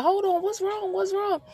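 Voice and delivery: high, startled woman asking what's wrong; mock alarmed woman, high voice; confused woman. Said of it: "She's like".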